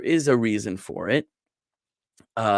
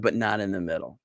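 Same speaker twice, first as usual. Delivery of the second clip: normal talking voice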